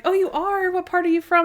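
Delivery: high, eager retold chatter; mock excited questioning. Caller asks producer about Wisconsin, mock excited and high.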